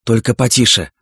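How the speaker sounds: plain reading voice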